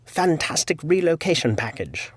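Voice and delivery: quoting in a put-on British accent; playful British impression of the email writer. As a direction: repeat the phrase in mock-British. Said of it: "he did write".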